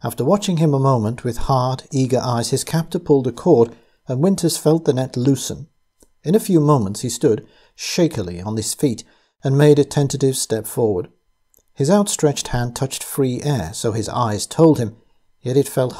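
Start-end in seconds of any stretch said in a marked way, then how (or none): none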